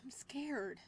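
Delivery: whispered, frightened; scared whisper. Acted. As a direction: breathe it out scared. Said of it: "I speak stutteringly".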